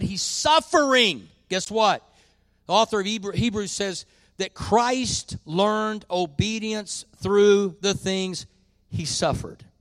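high, loud, slow preaching build; preaching at full force. Acted. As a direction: drive the point home from the pulpit.

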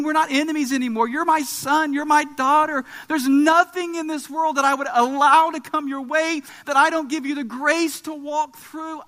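high, loud sermon crescendo; forceful, building pulpit delivery; impassioned preaching. Acted. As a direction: preach urgently as God pleading with his child. Preaches at full force, voicing God.

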